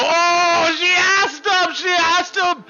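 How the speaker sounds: high, loud mock-excited teasing; teasing buddy. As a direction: crow it like a gleeful friend. Buddy teasing about the letter.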